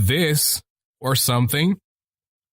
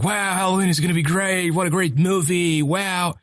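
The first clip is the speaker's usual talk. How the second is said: higher, sing-along gushing of fans; mock hype; 4 semitones higher than usual. People hyping up Halloween, mock-gushing. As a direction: imitate overexcited moviegoers. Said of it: "a lot of people going like".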